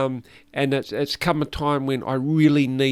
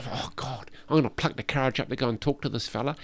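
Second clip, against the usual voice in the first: nervous inner thought; 9 dB quieter, faster than usual. Retold or imitated.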